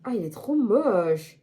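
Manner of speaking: usual speaking voice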